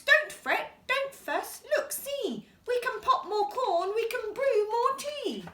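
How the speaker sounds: high, squeaky little mouse voice; small mouse squeaking complaints about the damp cave. Acted. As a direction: squeak it out like a tiny fussy mouse. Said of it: "Mouse squeaks".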